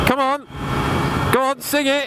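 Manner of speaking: high-pitched begging; high pleading voice. Acted. Begging someone to sing.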